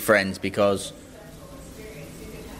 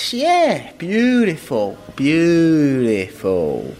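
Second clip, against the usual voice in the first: drawn-out; 5 semitones higher, much slower than usual. Pretend. Drawn-out exaggerated praise.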